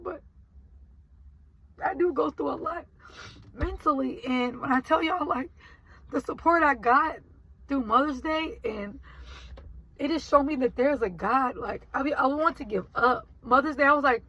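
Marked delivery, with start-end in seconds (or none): none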